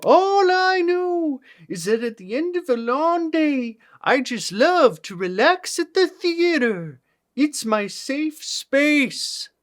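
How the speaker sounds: high, far-off presidential voice; Lincoln voice. Pretend. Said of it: "record audio based on a Daniel Day-Lewis voice".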